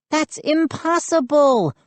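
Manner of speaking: put-on shocked girl's exclamation; crying out. A narrator's reading. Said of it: "Mindy cried".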